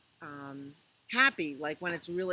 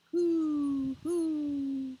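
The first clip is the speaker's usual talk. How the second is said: high, loud hooting owl call; 10 semitones higher, 8 dB louder than usual. Acted. Reading Good Night Owl to children, high loud owl hoot. Owl hoots in bedtime book.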